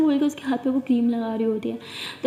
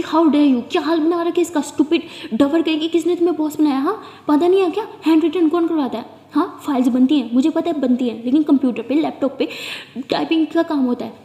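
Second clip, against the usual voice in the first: furious rant; 2 semitones higher, 6 dB louder than usual. Acted. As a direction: tear into her, shouting. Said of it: "बहुत गुस्से में होती है". (Boss पर ज़ोर से चिल्लाती गुस्से भरी डांट)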